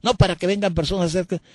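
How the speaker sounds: high, shrill-free but lifted indignant telling; imitating a pompous priest; self-righteous priest. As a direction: boast of throwing her out. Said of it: "dijo, ¿no?".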